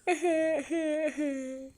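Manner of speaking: a breathy laugh after the fake crying. Real, not acted. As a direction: let the laugh spill out as the act breaks.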